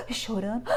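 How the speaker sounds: mock gasps between sobs; imitated sobbing gasps. Imitating the anxious girls crying, mock gasps. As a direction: gasp in like a crying girl.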